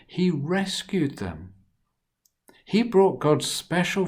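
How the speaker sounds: lively, expressive storybook reading; reading to children. A narrator's reading. Storyteller reading Joseph's story to children, animated. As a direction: tell it to the little ones with big feeling.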